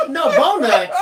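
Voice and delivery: mock "bonuts" with a b, giggling; laughing mock mispronunciation. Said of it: "finish eating before you start trying to talk".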